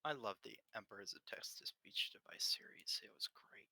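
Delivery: hushed whisper, no voice. Real, not acted. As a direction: whisper every word. GM whispers throughout.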